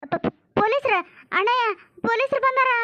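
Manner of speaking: high-pitched, stammering alarm; panicked warning. Acted. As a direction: warn your brother in a fright. Warns brother that police arrived. (ಗಾಬರಿಯಿಂದ ತೊದಲುತ್ತಾ ಎಚ್ಚರಿಸುವ ದನಿ)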